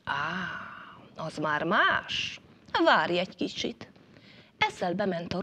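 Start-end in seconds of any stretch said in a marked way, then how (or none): 0.0-3.9 mollified. A narrator's reading. Fox to the crow on hearing of the dinner invitation, mollified.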